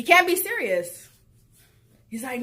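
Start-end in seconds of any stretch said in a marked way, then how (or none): 0.0-1.1 disbelief. Retold or imitated. Disbelief repeated, louder.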